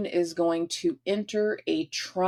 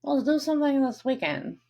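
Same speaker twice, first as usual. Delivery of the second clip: higher, mock-cheery replay of her own line; teasing replay; 7 semitones higher than usual. Shown as a demonstration. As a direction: throw her own words back in a sing-song, much higher voice. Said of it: "Notice I said that".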